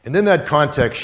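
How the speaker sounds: ordinary talk, nothing marked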